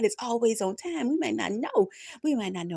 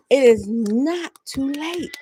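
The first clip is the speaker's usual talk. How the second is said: "It is not too late" slow and louder, each word leaned on; slow, loud emphasis; 2 semitones higher, 7 dB louder, much slower than usual. Acted. Preacher driving the promise home to viewers. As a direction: lean on "is" and draw it out.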